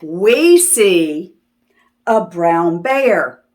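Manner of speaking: put-on lively sing-song read-aloud for little kids; reading to children. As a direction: read it like story time on the rug. Reading the last page aloud.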